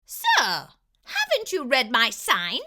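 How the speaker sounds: high, loud squeaky mouse shout; a tiny mouse calling out loudly, high; mouse dentist shouting. A narrator's reading. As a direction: shout up at the fox from the window. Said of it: "Doctor DeSoto shouted".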